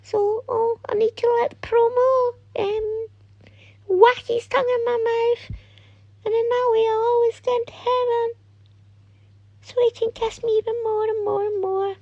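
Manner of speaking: very high, thin put-on little voice; squeaky mock-innocent inner voice; imagined frightened child. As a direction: mimic a naive kid's worried thoughts in a high monotone. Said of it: "and they'll be, like, thinking".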